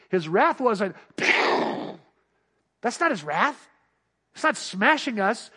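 normal talking voice

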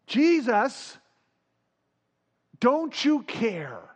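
mock cry out. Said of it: "they cry out to Jesus".

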